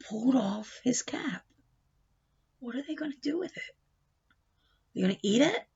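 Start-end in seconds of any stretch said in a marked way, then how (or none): 0.1-3.8 whispered suspense. A narrator's reading.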